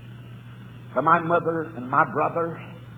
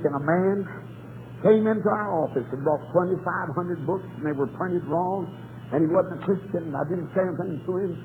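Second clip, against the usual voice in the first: usual speaking voice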